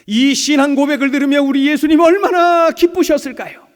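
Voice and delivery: high, loud, joyful preaching; exultant pulpit cry; fervent exclamation. Real, not acted. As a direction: lift the room with delight. Preacher exclaims with rising fervour.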